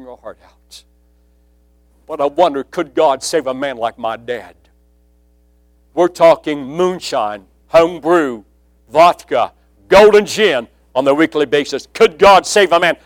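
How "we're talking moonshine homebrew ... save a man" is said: stack each drink louder than the last; building preaching. Acted. Preacher to congregation listing his dad's drinks, building loud.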